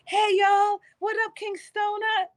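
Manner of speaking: bright high hey to chat; excited greeting. Real, not acted. Streamer greeting chat members excitedly. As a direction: call out hello to chat.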